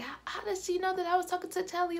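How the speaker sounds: high, fast indignant mock protest; boyfriend going off. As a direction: rattle it off high and outraged. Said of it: "He was going off".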